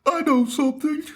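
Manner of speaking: goofy high-pitched bragging impression; mock know-it-all. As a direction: play the clueless heckler bragging. Mocking the jiu-jitsu heckler.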